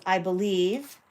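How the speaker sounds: ordinary talk, nothing marked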